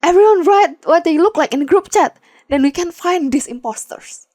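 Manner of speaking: man shouting instructions to the van, loud. A narrator's reading. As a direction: bark the plan over the chaos. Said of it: "shouts the man beside Izuku".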